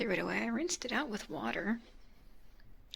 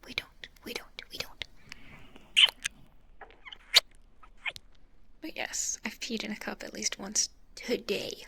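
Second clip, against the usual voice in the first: whispering. Real, not acted. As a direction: keep it all breathy and whispered. Whispers through the stream.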